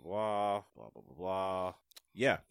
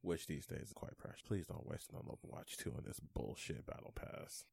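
a whispered warning, just under the breath; whispered aside; 5 semitones lower, 13 dB quieter than usual. Real, not acted. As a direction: whisper it, like a secret tip. Unvoiced aside.

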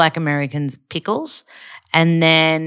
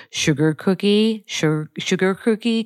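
imitated American accent, rhotic R in "sugar"; 4 semitones higher than usual. Dialect coach gives a trigger phrase, American accent. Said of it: "in American accent".

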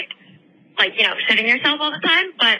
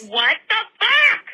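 high, slow stunned disbelief; shocked; 11 semitones higher, slower than usual. Retold or imitated. Retelling the sister's news, stunned high disbelief. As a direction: drag it out in disbelief.

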